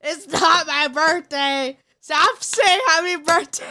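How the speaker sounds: loud, laughing protest; exasperated yell. Real, not acted. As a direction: shout it down, fed up but amused. Chat keeps wishing happy birthday.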